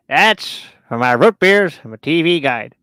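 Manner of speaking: loud slow hillbilly drawl. Acted. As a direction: ham up a backwoods yokel. Mocking hillbilly character.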